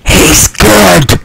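loud, punchy rebuttal; loud insisting. Real, not acted. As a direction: insist it loudly over a friend's protest. Loud insisting over friend's modesty.

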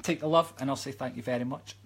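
usual speaking voice

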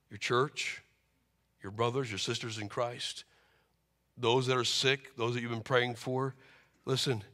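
hushed altar appeal. Acted. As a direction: bring it down to an intimate plea to the congregation.

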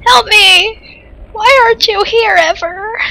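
high, drawn-out wail; distressed plea. Real, not acted. Player begging absent friend for help, distressed plea.

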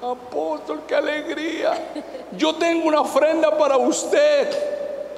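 put-on high, slow little old lady voice; high tender granny voice. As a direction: play the sweet old lady offering a gift. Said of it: "viene una viejita y me dice".

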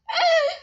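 short, high, loud yelp; startled scream. Real, not acted. Player spooked by the game, startled scream. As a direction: yelp in sudden fright.

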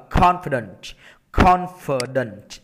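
"confident" spaced out with a leading CON; stress on first syllable. Shown as a demonstration. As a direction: hit CON in "confident", then fall. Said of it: "em những âm một cho thầy".

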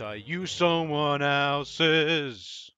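very slow, each word stretched out; hammed-up slow, solemn take; mock dramatic admission. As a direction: drag it out like a tragic confession.

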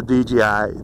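D J I slurred with a Pittsburgh accent. Real, not acted. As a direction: let a Pittsburgh accent slip into D J I. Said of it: "that's my Pittsburgh accent".

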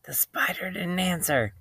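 soft, whispery narration; hushed bedtime-story whisper. A narrator's reading. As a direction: whisper it so as not to wake a sleeper. Spider has fallen asleep.

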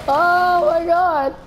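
high, slow, drawn-out exclamation; amused disbelief. Real, not acted. Player watching cars hit people, amused disbelief. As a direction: cry out between bursts of laughter. Laughing at game carnage.

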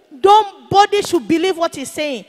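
high, loud mock protest; forceful objection. Man stands up against daddy's teaching, loud forceful objection. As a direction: stand up and call it out over the room. Acted interruption by the opposing man.

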